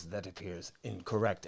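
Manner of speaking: low, very soft deadpan; understated villain quote. Retold or imitated. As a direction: drop it low and quiet, imitating a villain. Voicing villain in retold comic scene.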